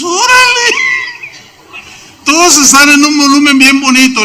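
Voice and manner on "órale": mock startled cry. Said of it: "Y tú dices".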